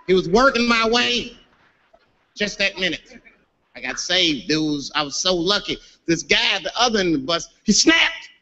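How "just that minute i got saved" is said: ordinary delivery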